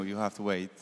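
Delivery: ordinary talk, nothing marked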